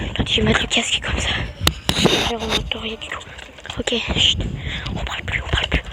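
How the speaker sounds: hushed whisper so nobody hears. Real, not acted. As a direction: keep it secret as someone passes. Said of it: "une vieille qui a passé".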